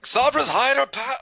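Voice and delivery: high, drawn-out prayer call; yelled prayer. Acted. Cleric calling on a god to hide the party, yelling. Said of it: "I yell out a prayer".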